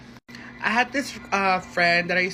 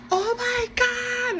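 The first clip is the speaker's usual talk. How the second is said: high, slow, stretched-out gasp of shock; freaking out; much slower than usual. Retold or imitated. Friend on FaceTime learns the guy is her friend's, shocked. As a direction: drag out the disbelief way up high. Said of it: "she was freaking out".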